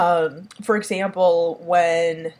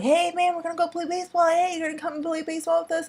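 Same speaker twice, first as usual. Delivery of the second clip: put-on much higher, eager kid voices; kids calling; 10 semitones higher, faster than usual. Campers inviting a friend to baseball, high eager voices. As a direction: chirp it like excited young campers. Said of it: "all the other kids are like".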